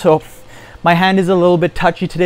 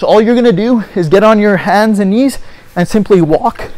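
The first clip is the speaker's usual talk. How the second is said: ordinary talk, nothing marked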